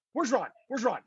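soft, puzzled double question; hushed mock confusion; retold bewilderment. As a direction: imitate someone quietly baffled. Retold clueless driver looking for Ron.

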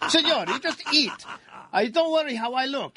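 mock-indignant Italian waiter accent. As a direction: play a blunt Italian waiter. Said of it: "his Italian accent".

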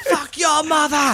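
high, loud, drawn-out Boston shout; Boston accent. Retold or imitated. Friend imitates passenger cursing toll guy, loud Boston shout. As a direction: bellow it as an irate Bostonian. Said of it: "speaking in my best Boston accent".

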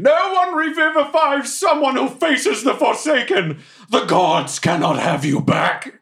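high, anguished proclamation; tearful yell. Acted. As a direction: cry it out to the whole arena. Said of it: "I yell while still crying".

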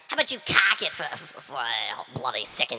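put-on a light Australian accent, mildly scolding; telling Tim off in a hammed-up Aussie accent; mock Australian insult. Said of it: "My Australian accent isn't the best".

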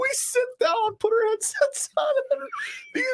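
squeaking the words out, high, through laughter; helpless laughter. Real, not acted. Co-host recalling the pair at their headsets, high breathless laughing. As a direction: keep telling it while cracking up. Still cracking up telling the story.

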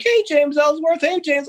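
high, sing-song call from a crowd; fans calling out. Retold or imitated. Arena fans calling his name, mildly imitated high and excited. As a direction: call his name across the concourse like an eager fan.

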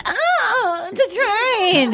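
high, slow silly dog voice; voicing the fur baby, high and drawn out.

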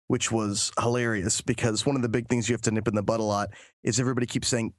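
ordinary talk

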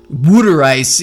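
WATER said as "wooder", stressed and loud; New Jersey pronunciation. Shown as a demonstration. Co-host shows how New Jersey orders water ice, WATER as "wooder". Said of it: "how weird the pronunciations can get".

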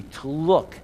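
ordinary delivery

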